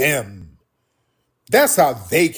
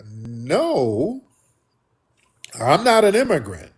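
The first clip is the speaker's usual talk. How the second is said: usual speaking voice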